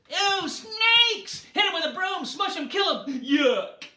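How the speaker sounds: high, loud shrieks of disgust; shrill squeals of ew and kill; disgusted shouting. Acted. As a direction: yell like a crowd grabbing brooms. People chasing off the snakes.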